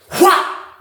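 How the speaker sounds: sharp high whop; imitated punch sound. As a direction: smack the sound out.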